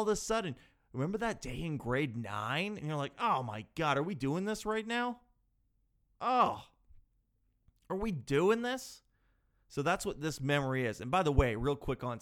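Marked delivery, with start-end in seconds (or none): 3.2-9.0 exasperated disbelief, pitch jumping up; higher, incredulous complaint. Acted. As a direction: groan at an unwelcome memory. Dreading an unwelcome memory at night.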